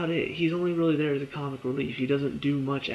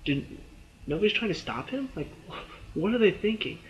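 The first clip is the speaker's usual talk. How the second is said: baffled disbelief; 6 semitones higher than usual. Retold or imitated. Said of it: "I'm just like".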